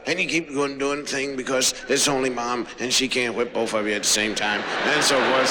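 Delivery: ordinary talk, nothing marked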